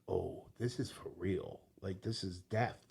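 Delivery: soft, slow voice as the truth sinks in; quiet dawning dread. Retold or imitated. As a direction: let it land quietly, almost to yourself. Realization of death retold.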